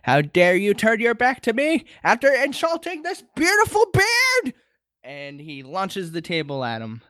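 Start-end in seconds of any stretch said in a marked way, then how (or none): 0.0-4.5 put-on high, climbing old-man outrage; hammed-up affronted geezer; acted indignation. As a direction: build to a squealing high finish. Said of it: "he says".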